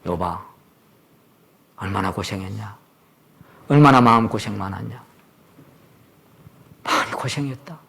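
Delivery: 부드럽고 조용하게 위로하듯; tender apology. Retold or imitated. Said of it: "나중에 욥에게 얘기해야 된다".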